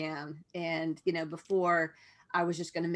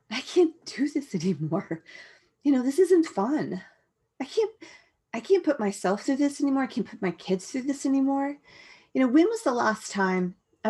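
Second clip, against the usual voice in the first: raised, higher and louder insistence; fed-up resolve; 6 semitones higher, 6 dB louder than usual. Retold or imitated. Mother telling herself she must stop, fed-up resolve. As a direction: plead with yourself, voice lifted.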